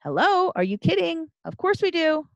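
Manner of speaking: high-pitched, incredulous comeback; incredulous retort. Acted. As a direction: act out can't-believe-it disbelief. Acted incredulous retort in debate.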